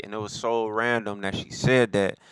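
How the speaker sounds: ordinary talk, nothing marked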